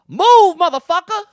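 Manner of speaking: high, explosive yell of an order; mock city worker screaming at a driver; retold furious shout. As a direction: bellow it like a traffic officer at a stalled driver. Retold traffic official's shouted order.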